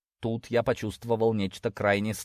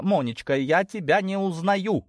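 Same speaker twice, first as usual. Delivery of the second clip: higher, drawn-out reproach; indignant mother; 9 semitones higher than usual. A narrator's reading. Mother shocked at her daughter's behaviour, indignant. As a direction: scold your child in a raised pitch. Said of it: "возмутилась Тома".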